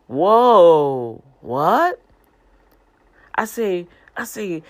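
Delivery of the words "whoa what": loud stunned whoa, what rising; floored by God's answer, shouting whoa; amazed whoa. Acted. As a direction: blurt it out in loud disbelief. Reacting to God's surprising reply.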